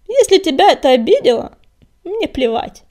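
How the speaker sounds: loud, high, dismissive snarl; rude bark. A narrator's reading. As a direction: bark it with contempt. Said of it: "грубо рявкнул он".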